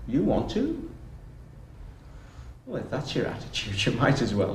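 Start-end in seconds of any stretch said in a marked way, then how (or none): none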